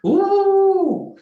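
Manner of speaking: high, drawn-out hoot on one note; piercingly high held cry; high sustained "ooh". Acted. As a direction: let out a long, high "ooh". Sound imitated after "full of guilt.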